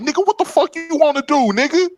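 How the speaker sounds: high, heated shout of a challenge; retold angry challenge. Trucker squaring up to a drunk at the gas station, high heated shout. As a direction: dare him to step up.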